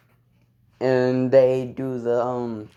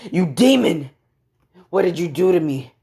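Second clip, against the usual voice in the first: higher, louder outraged cry; shaken accusation; 5 semitones higher, 5 dB louder than usual. Acted. Revived rival accuses Deku.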